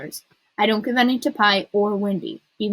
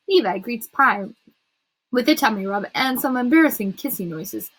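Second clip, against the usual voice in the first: ordinary delivery, nothing marked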